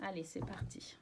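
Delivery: hushed, breathy whisper to herself. Real, not acted. Tarot reader launching the draw for viewers, in a whisper. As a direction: whisper it as you start shuffling. Starting the card draw quietly.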